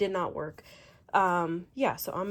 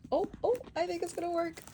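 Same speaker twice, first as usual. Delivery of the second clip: excited; 10 semitones higher, faster than usual. Real, not acted. Hopeful moment while fitting cover.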